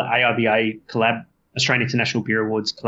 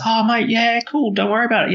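eager reassurance; 12 semitones higher than usual. Retold or imitated. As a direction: throw it out like a mate happy to help. Friend eagerly reassures the brewer.

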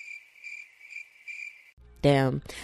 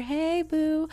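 a much higher, bright "hey, boo"; bubbly greeting; 11 semitones higher, 3 dB louder than usual. Real, not acted. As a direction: greet newcomers with a lifted squeal. Greeting new listeners.

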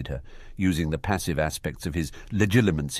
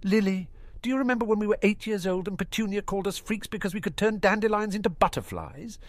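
rising scorn, then cold; bitter accusation building; accusing; 12 semitones higher than usual. Acted. Snape accusing.

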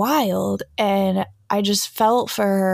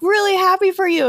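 much higher gushing congratulations; gushing happy; 11 semitones higher, 4 dB louder than usual. Retold or imitated. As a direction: gush into the group chat. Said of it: "we're like".

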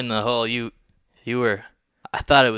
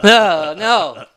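high, loud anguished no; anguished cry; 9 semitones higher, 9 dB louder than usual. Acted. Method actor convulsing in character.

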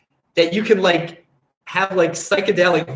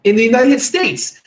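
louder, higher mock Australian ad pitch; badly faked Australian accent, pushed up; horrible Australian accent; 6 semitones higher, 6 dB louder than usual. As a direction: belt out a bad Australian advert impression. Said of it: "excuse my horrible Australian accent".

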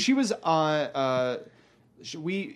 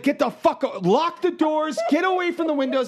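urgent warning; 11 semitones higher, 3 dB louder than usual. Retold or imitated. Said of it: "She's like".

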